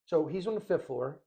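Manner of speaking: usual speaking voice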